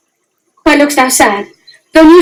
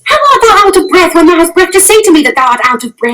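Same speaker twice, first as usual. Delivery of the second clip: impatient; 4 semitones higher, faster than usual. Acted. Juliet retorts rapidly.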